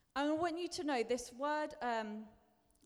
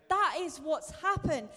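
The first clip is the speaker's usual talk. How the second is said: louder emphasis; 4 semitones higher, 7 dB louder than usual. Real, not acted.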